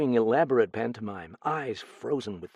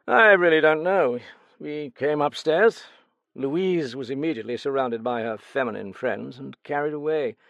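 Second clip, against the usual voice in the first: a lightly voiced, raised-pitch guarded gentleman; Vautrel's reply; 3 semitones higher, 6 dB louder than usual. Vautrel answering the detective, lightly voiced and guarded. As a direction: answer coolly, a touch higher than the narration.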